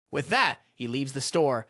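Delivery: plain reading voice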